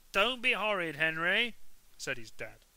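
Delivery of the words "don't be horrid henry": higher, much louder telling-off; dad scolding Henry at dinner. A narrator's reading. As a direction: bark at him to behave.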